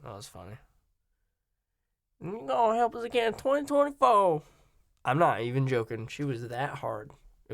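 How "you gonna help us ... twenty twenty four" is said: mocking Harris in a put-on Southern accent; Kamala Harris southern accent. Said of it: "Kamala Harris saying shit with a southern accent".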